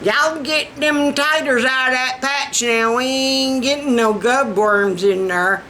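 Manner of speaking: hammed-up poor Southern farmhand. Shown as a demonstration. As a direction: put on a poor rural Southern twang. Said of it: "I can talk poor Southern".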